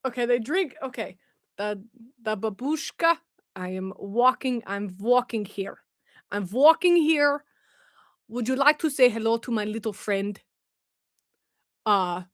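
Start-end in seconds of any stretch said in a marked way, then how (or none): 1.6-10.4 Russian with New Yorker accent. Shown as a demonstration. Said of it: "Russian with a New Yorker accent".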